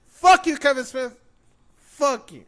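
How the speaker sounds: high, loud curse easing slightly; real outrage. Real, not acted. Fan cursing the writer who killed his hero, outraged. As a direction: spit out the curse in high-pitched fury.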